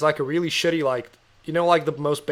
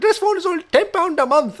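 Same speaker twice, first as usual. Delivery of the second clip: put-on high, louder Indian-accented sales pitch; imitating an Indian-accented caller, voice pitched up; 5 dB louder than usual. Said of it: "I'm doing an Indian accent".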